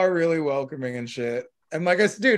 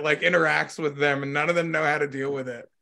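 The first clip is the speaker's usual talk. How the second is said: ordinary talk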